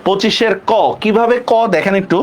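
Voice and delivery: usual speaking voice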